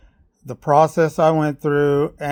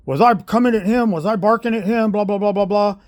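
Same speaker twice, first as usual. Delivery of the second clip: high, insistent mimicked self-defense; mocking protest; 6 semitones higher, 4 dB louder than usual. Coach turning round to defend himself, mocking imitation. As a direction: mimic someone protesting, running into blahs.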